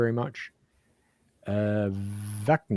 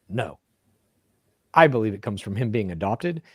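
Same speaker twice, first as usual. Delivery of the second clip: normal talking voice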